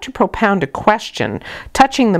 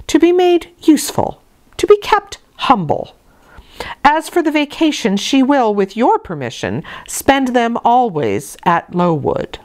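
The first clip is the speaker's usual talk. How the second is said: cold haughty lady; 6 semitones higher than usual. Acted. Benefactress telling the clergyman to keep the girl humble, high and imperious. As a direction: look down on the child as you dictate her fate. Mrs Reed instructs Brocklehurst.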